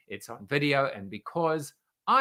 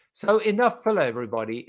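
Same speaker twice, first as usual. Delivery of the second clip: brisk switch; 7 semitones higher, 8 dB louder, faster than usual. Real, not acted. Host switches to main segment.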